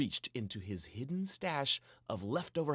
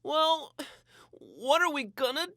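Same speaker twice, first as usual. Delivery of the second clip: much higher, slow, drawn-out whine; dramatic; 9 dB louder, slower than usual. A narrator's reading. Jack moaning to Papa about being stuck inside, dramatically. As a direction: ham up the boredom. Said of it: "Jack asked dramatically".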